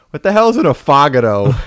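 loud high baffled yell; mock outburst. Reading a strange menu word, loud baffled outburst. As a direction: blurt it out at the menu. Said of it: "and you go".

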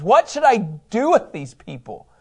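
slow, mildly exasperated plea; exasperated cry. Acted. Preacher voicing Moses crying out to God, exasperated. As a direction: draw it out as a mock plea. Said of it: "he at least cries out to the Lord".

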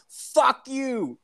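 high mock yell of a clueless kid; imitating Ron's yell. Ron yells at the old man at the door, high mock yell. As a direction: yelp it up high, dumb and defiant.

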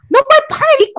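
loud, high-pitched mom outburst; imitated yelling. Imitating mom storming into her room, loud yelling. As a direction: burst out high and loud like an angry parent. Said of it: "and she starts yelling".

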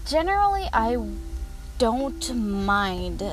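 ordinary talk

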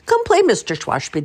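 put-on little girl calls to grumpy neighbour; high child calling.

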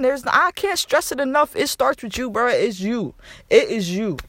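ordinary talk

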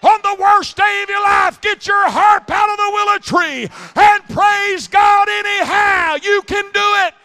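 full-force preaching. Acted. Preacher urging the congregation to praise anyway, shouting. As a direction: drive it home at the top of your lungs.

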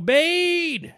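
high, loud call of "Obaid!"; mock announcer shout. Fan hollering a favourite announcer's name, loud and high. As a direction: belt out "Obaid!" like a ring announcer.

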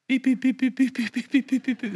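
quick string of high beeps; high repeated beep noises; imitated beeping. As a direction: beep rapidly like a little homing signal. Imitates beeping while finding the right person.

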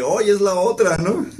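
high and loud, laughing through the joke; retelling his own tease with laughter in the voice; laughing punchline. Retold or imitated. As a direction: deliver the punchline cracking up.

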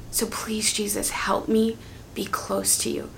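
unvoiced whispered plea to Jesus; a hushed whisper asking for closeness. Shown as a demonstration. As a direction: whisper it close, barely there. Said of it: "moved through that very intense".

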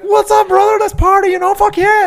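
high-pitched party-hungry yelling; hammed-up shrieking hype; freaking out. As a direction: imitate a wildly excited stoner, full volume. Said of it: "he fucking freaks out".

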